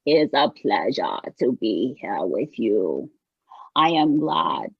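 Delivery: deep-pitched, measured trance-medium voice; Silver Cloud. Acted. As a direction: greet the room as an ancient channelled spirit. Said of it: "We have Silver Cloud coming through".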